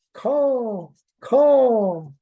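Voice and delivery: high loud raven caw. A narrator's reading. He became Raven again.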